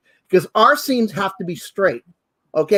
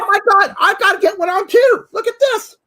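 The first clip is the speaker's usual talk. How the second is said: high and louder, thrilled; delighted squeal; 11 semitones higher, 4 dB louder than usual. Real, not acted. Host grabbing a Santa hat to match co-host, delighted squeal.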